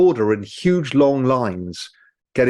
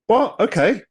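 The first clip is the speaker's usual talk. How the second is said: mock surprise; 8 semitones higher than usual. Voters stunned by referendum.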